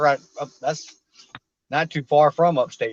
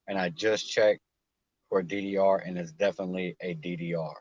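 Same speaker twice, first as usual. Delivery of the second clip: low, soft read-out of a message; subdued reading voice; reading aloud; 5 semitones lower, 5 dB quieter than usual. Acted. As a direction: read it out quietly in a low, even voice.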